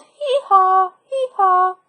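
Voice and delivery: high hee-haw bray; a playful donkey hee-haw. Acted. Donkey braying.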